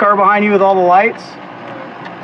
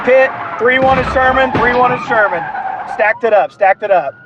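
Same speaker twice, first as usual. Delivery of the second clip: excited radio call; 7 semitones higher than usual. Real, not acted. Pursuit ends in a crash.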